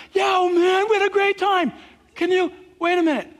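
put-on high, loud drunken enthusiasm; tipsy reveller. Drinker mid-party telling of a great time, tipsy. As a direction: whoop it up boozily, then break off. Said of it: "you get affected by it".

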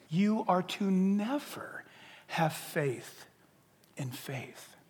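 slow emphatic words sinking to a whisper; slow, dropping to a whisper. Shown as a demonstration. Preacher correcting a TV preacher's line, slow and hushed. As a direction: spell out the correction slowly, fading to a whisper. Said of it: "that statement's insane".

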